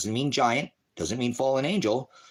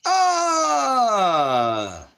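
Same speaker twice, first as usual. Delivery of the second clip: 1 sharp yelp; 12 semitones higher, 6 dB louder than usual. Real, not acted. Outburst mid-argument.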